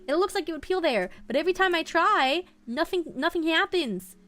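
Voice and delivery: frustrated, with a hint of a Minnesota accent; a touch of Minnesota twang while fighting a sticker. Real, not acted. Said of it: "I sounded so Minnesota there".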